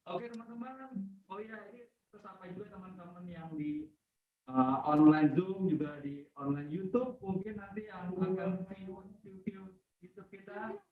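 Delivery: faint, drifting in and out; barely audible. Real, not acted. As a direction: voice fading far from mic. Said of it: "timbul tenggelam". (suara timbul tenggelam)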